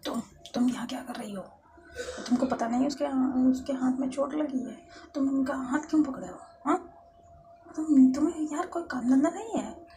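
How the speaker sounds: loud, high angry scolding. Acted. Jealous lover confronting Khushi at Lovely's bedside, very angry. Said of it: "Faiz को बहुत गुस्सा आता है".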